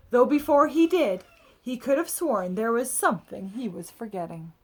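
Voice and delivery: expressive read-aloud storytelling; picture book narration. A narrator's reading. Adult reading a picture book to children, lively storybook voice. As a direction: tell it like a bedtime story for kids.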